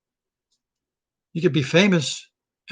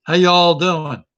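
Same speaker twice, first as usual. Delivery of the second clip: loud, slow Southern greeting with a drawn-out y'all; Southern accent; 6 dB louder, slower than usual. Acted. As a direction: greet them Southern style, leaning on y'all.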